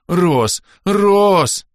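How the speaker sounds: loud high shouted name; panicked yell. A narrator's reading. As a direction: scream for help. Panicked calling.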